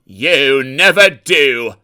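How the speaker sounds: cutting rebuke; stern voice. A narrator's reading. Controller sternly rebuking Sir Handel, slow and loud. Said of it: "said a stern voice".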